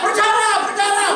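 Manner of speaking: high, loud cry of alarm, imitated; mock yelling. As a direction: yell it twice like a brother under a beating. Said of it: "Tapi dia teriak". (teriakan panik tiruan)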